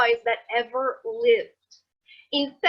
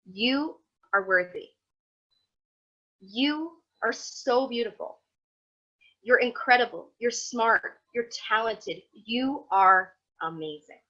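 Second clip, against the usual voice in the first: gentle affirming voice. Shown as a demonstration. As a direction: say it like a friend who means it. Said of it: "What you have to do instead".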